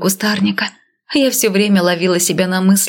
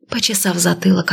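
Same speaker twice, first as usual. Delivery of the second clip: plain reading voice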